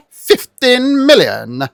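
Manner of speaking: high, drawn-out incredulous question; slow, high-pitched disbelief; mock disbelief. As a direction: stretch it out in mock astonishment. Acts Napoleon's incredulous reply.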